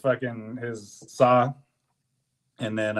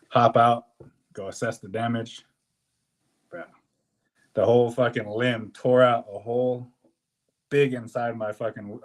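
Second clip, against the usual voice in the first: ordinary talk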